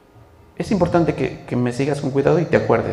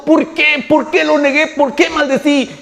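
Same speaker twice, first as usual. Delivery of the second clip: high, loud anguished wail of regret; acted tearful lament; 11 semitones higher, 5 dB louder than usual. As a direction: cry out in self-reproach, hammed up. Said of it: "el otro llorando". (lamento lloroso actuado, agudo y fuerte)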